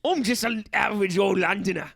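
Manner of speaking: hammed-up Londoner; Cockney accent. London driver introducing himself to his passenger, broad Cockney. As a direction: put on a broad Cockney cabbie voice. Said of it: "why is it all Cockney".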